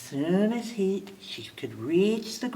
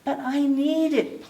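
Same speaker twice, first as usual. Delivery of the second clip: pleading; 9 semitones higher than usual. A narrator's reading. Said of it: "pleaded Figgy".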